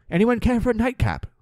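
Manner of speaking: put-on high British accent, inviting; British accent, sweetly offering a drink; British old lady. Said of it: "Anne, eighty-two".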